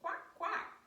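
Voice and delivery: high quick quacks; duck quack. Pretend. Storyteller introduces the duck to the children, high duck quack. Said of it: "And the duck says".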